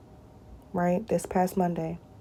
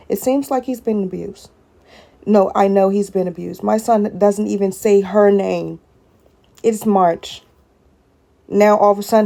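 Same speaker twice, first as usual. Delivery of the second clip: louder, rising furious outburst; building to an angry near-shout; real anger; 7 dB louder than usual. Real, not acted. Mother venting to viewers about her son's abuse, furious and loud.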